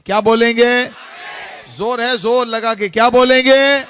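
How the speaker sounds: rallying shout, demanding a full answer; loud call to crowd. Real, not acted. Preacher urging the crowd to answer with force, loud call.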